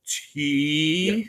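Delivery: drawn-out T in the Sheik's voice; slow Sheik letter. Retold or imitated. Sheik writing an autograph, drawn-out slow letter. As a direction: stretch the letter slowly like the Sheik. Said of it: "very slow".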